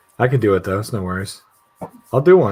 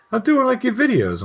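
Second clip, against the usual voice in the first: lightly voiced mom, pitched much higher; imitated amused mom. Mom owning up to her son, high imitated voice. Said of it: "And she's laughing".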